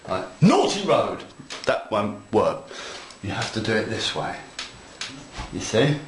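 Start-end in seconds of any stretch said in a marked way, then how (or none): 0.4-1.2 mock telling-off of the road, pitched up; playful fake scolding. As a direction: scold the road like a naughty child, half joking. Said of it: "you shout at it and distress it".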